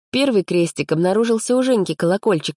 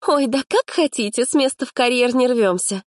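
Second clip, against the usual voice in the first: high, huffy dismissal; snorting; 8 semitones higher than usual. A narrator's reading. Girl scorns the lad's orders, snorting. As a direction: huff it out high, girlishly unimpressed. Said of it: "фыркнула Аллочка".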